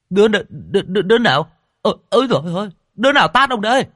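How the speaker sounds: high, loud stammering outrage; stuttering slapped man; shocked stammering. A narrator's reading. Slapped rich man demands who hit him, stammering.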